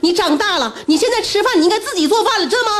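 high-pitched mock-hysterical scolding; retold angry outburst. Overwhelmed mother berating her son in the retelling, high-pitched outburst. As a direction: fire indignant questions, high and exasperated. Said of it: "我大声就控诉他".

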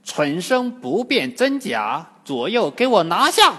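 statue demon commands the seizure; booming shouted order; commanding shout. A narrator's reading. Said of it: "开口喝道".

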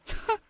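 soft dismissive "hmph"; brief, quiet scoff; haughty little snort; scornful snort. A narrator's reading. Villainess scoffs in reply.

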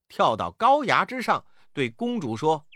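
ordinary delivery, nothing marked

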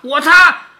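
high, loud call-out; eager volunteering. Acted. Pupil answering the teacher's chore question, eager shout. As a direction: pipe up loudly like a keen pupil.

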